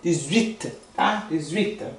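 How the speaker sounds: dix-huit softly, U gliding into I. Shown as a demonstration. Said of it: "Fala a boquinha de U, fala I". (dix-huit mais baixo, U para I)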